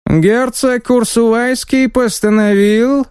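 pompous envoy with a squeaky voice; high squeaky voice. Acted. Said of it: "высоким, громким и совсем немного писклявым голосом".

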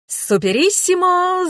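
high, drawn-out exclamation; excited cry. A narrator's reading. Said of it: "закричал Энрике".

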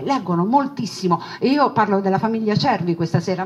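ordinary delivery

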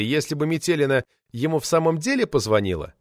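ordinary delivery

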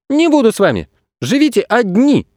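put-on high-pitched child's outburst; boy shouting. A narrator's reading. Boy giving up on everyone, shouting. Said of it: "крикнул Федя".

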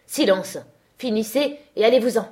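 loud, sharp order to leave; angry command. A narrator's reading. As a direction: silence them forcefully. Master orders defiant girl out.

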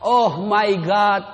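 mock pleading prayer, slow and drawn out; child praying in English. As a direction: draw out a hammed-up plea to God. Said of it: "anak berdoa". (doa anak yang memelas dibuat-buat)

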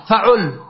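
فعل with a after f, u after ʕ; a then u. Shown as a demonstration. Teacher showing students the u-middle pattern, "faʕul". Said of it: "مع ضم العين".

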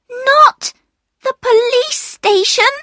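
put-on very high child's voice, pleading; alarmed cry. A narrator's reading. Another child crying out from the darkness about the police, high alarm. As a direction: squeal the question in alarm. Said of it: "cried another voice".

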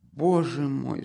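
understated cry of dismay. Acted. Mother crying out at her son's demand, dismayed. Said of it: "вскричала Пульхерия Александровна".

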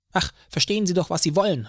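high, irritated retort; snapped angrily. A narrator's reading. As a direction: snap it back in exasperation. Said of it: "fauchte der Mann". (gereizt gefaucht)

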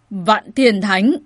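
loud, slow, drawn-out name full of loathing; cold, venomous emphasis; icy voice. A narrator's reading. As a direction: spit the name out coldly. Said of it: "Hán tử cao lớn lạnh giọng phun ra cái tên này".

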